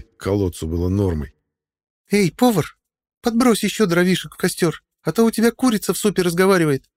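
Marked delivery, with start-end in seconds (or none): 2.1-6.8 put-on high, biting young woman; caustic girl. A narrator's reading. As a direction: needle him sarcastically. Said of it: "едко сказала Кара".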